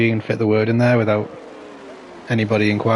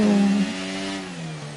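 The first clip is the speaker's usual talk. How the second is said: laughing; 10 semitones higher than usual. Real, not acted. Laugh after near crash.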